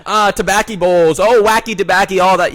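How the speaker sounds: loud, high derisive rant; mocking the left. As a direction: rattle off mock outrage at full volume. Hyper guest mocks the left.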